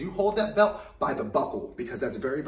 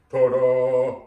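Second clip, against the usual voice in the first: dad voice; 4 semitones lower, 9 dB louder than usual. Shown as a demonstration. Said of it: "you sound like my dad".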